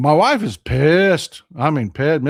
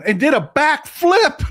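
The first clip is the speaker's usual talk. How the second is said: usual speaking voice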